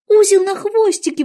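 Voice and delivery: put-on high, loud girlish exclamation; eager exclamation. A narrator's reading. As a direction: cry out, keen to help. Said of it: "воскликнула Алиса". (восторженно воскликнуть)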